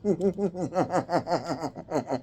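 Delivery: quiet falling chuckle; soft laugh. Real, not acted. Reader trying to hold in laughter, soft laughing. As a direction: chuckle under the breath. Still laughing during reading.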